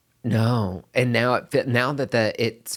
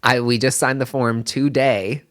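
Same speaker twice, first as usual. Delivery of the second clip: usual speaking voice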